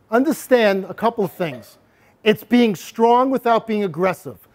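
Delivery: ordinary delivery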